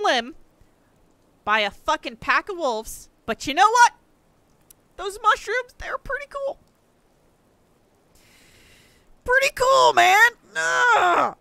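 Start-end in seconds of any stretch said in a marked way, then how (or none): 5.0-11.4 high, loud strained enthusiasm; sarcastic forced cheer. Real, not acted. Gamer praising mushrooms while wolves maul him. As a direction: fake delight through the pain.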